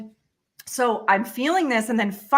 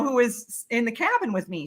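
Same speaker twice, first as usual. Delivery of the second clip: ordinary talk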